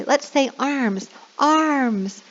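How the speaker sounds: lightly sing-song prompt; teacher prompt. Shown as a demonstration. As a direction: model the word for kids to repeat. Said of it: "So I say".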